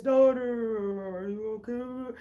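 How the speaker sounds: warped, echoing call from far away; like hearing through a haze; dad's voice muffled and distant; distorted far-off voice. Retold or imitated. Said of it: "but it sounded like this".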